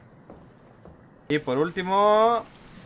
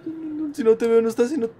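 usual speaking voice

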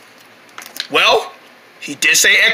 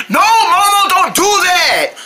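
alarmed shout; 10 semitones higher, slower than usual. Acted. Stopping Momo from falling in.

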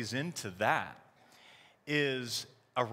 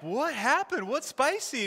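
puzzled dad; 10 semitones higher, slower than usual. Retold or imitated. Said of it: "And I'm like".

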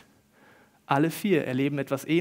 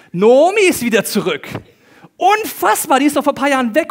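loud, high, astonished exclaiming; acted excited gossip; 10 semitones higher, 11 dB louder than usual. Townswomen reacting to Nomi's return, acted excited gossip. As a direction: spread sensational news, hammed-up.

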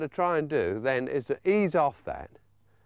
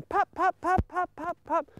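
put-on little boy calling; 14 semitones higher than usual. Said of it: "Billy ... running about after him, going".

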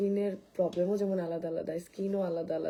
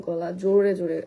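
louder, almost shouting with a sore throat; talking loudly despite a sore throat; 9 dB louder than usual. Real, not acted. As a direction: push the voice out hard over the rasp. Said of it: "মানে চিল্লাচ্ছি".

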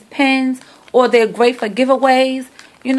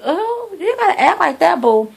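higher, sing-song teasing comeback; teasing mock offense; 8 semitones higher than usual. To her friend over the attitude, mock offended and much higher. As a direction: playfully call out her friend's sass. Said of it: "I was like".

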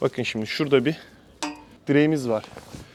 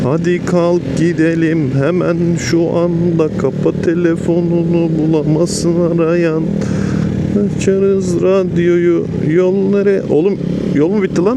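shouting over wind; 5 semitones higher, 9 dB louder, slower than usual. Real, not acted. Shouting over wind while riding.

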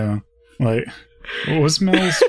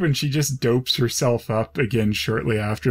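ordinary talk, nothing marked

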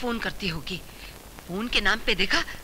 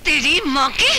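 loud, high angry burst; angry outburst; 6 semitones higher, 7 dB louder than usual. Acted. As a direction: blurt it in fury. Wife bursts in angrily.

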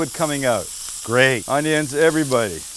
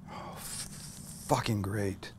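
whispered swear; 5 semitones lower, 16 dB quieter, slower than usual. Real, not acted. Swearing under breath with delight.

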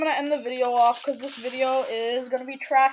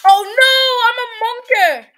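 high, loud wail of a monkey; mock-panicked monkey; 9 semitones higher, 10 dB louder than usual. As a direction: cry out in pretend monkey alarm. Plays a monkey in distress.

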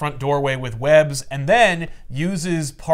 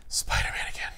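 hushed, annoyed whisper; weary whispered grumble; 9 dB quieter than usual. Retold or imitated. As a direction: breathe it out under your breath. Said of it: "and he's like".

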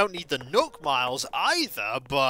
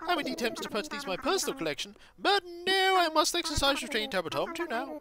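high, fluttery curator; all aflutter and eager, pitched high; excited owl; 11 semitones higher, faster than usual. Acted. Owl curator eager to assess fossils, high and fluttery.

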